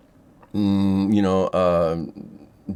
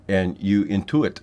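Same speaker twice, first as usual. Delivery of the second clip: ordinary delivery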